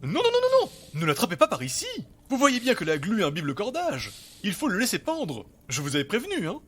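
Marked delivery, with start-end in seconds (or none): 0.0-0.6 high, loud panicked cries; panicked shout. Acted. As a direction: yelp in alarm to stop him. Scientist panics at net grab.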